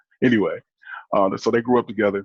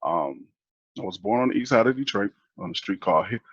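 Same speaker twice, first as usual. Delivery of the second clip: normal talking voice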